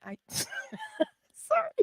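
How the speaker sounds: breaking into high laughter mid-sentence; can't hold the laugh in. Real, not acted. Mother laughing about her funny son, real laughter. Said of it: "The reason I laugh".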